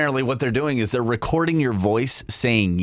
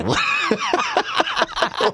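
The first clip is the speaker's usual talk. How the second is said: long, high-pitched laugh; high giggling fit; cracking up. Real, not acted. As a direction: burst out laughing helplessly. Host cracks up at breathy caller.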